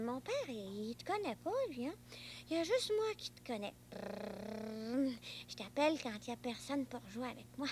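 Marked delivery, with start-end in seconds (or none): 3.9-5.1 low rumbling brrrm of a taxi motor; car engine noise. Pretend. Boy pretends to drive his imaginary friend in a taxi, engine rumble.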